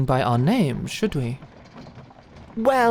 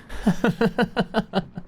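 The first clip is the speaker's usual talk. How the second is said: amused laugh; 5 semitones higher than usual. Acted. Knight laughing at the princess's blush, chuckling.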